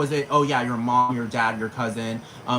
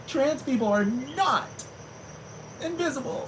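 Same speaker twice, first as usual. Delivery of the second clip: high, slow, whimpering mock sob; 13 semitones higher, 4 dB quieter than usual. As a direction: ham up a tearful pop star plea. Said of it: "She was like".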